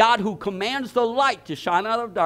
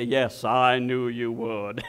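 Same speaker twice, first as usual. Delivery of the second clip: much lower, slow, self-satisfied drawl; smug know-it-all; 10 semitones lower, much slower than usual. Pretend. Low slow smug told-you-so. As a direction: pastor mocks the smug answer he never gave. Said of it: "I didn't say".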